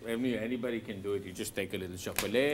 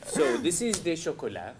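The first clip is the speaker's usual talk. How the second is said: put-on French accent; 5 semitones higher, 5 dB louder than usual. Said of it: "speak in the French accent".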